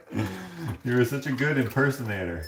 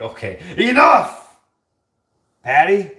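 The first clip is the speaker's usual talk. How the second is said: high, loud outburst at a pest; acted exasperated yell; mock fed-up shout; 7 semitones higher, 10 dB louder, slower than usual. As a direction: play snapping at someone, loud and high. Acting fed-up homeowner scolding ghost.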